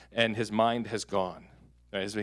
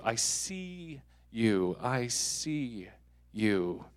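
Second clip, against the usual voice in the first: tender; 6 dB quieter, much slower than usual. Shown as a demonstration. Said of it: "modelling affirming words slowly".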